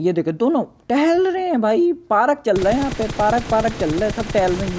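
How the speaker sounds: high, loud, amused disbelief at strolling enemies; excited mocking commentary. Real, not acted. Gamer teasing enemies strolling in the open, high and loud. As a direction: laugh at them walking around in the open.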